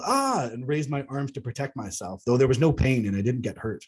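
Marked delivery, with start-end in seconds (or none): 0.1-0.5 sudden high yelp of surprise; startled yell. Retold or imitated. Startled abductee yelps when psychically slapped. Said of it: "I yelled".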